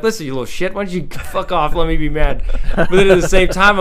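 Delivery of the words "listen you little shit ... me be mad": high, mock irritated rant at the kid; mock angry snap. Dad imagining snapping at his toddler, mock irritated. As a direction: pretend to lose patience. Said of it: "I kinda wanna be like".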